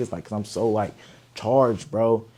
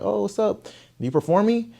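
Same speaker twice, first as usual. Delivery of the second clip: much higher, surprised; surprised greeting; 13 semitones higher, 5 dB louder than usual. Retold or imitated. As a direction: act caught off guard. Staff surprised at his arrival.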